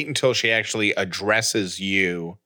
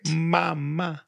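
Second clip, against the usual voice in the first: put-on baby voice; 6 semitones higher, 4 dB quieter than usual. Imitating baby saying mama.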